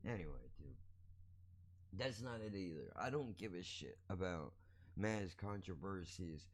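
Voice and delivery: normal talking voice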